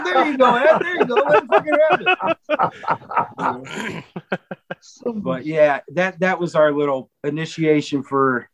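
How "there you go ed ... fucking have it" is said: higher, cheering through laughter; delighted praise of the comeback; laughing encouragement. Retold or imitated. Cheering Ed on after he tells Matt off, laughing. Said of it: "I go".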